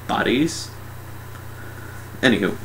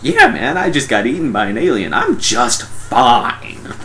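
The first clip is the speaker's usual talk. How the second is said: sarcastic reassurance; 2 semitones higher, slower than usual. Acted. Eaten dude answering friends, sarcastic. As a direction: stretch the ending with comic sarcasm.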